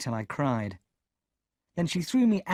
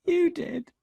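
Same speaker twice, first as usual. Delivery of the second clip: sobbing. A narrator's reading. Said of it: "I sobbed".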